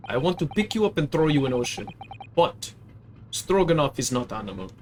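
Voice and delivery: put-on broken English in a heavy Russian accent; proud Russian crewman correcting the girl; gruff Russian sailor. Sailor to the maid, Russian accent, offended.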